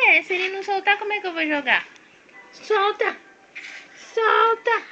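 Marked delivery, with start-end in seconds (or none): 2.5-4.8 high, raised command to a dog; playfully insistent high call. Real, not acted. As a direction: order the pet to let go. Ordering dog to release toy.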